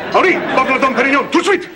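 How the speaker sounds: calling out. Acted. Calls an order across the restaurant.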